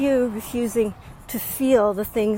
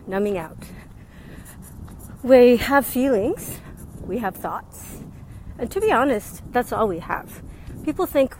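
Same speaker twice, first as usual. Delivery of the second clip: ordinary talk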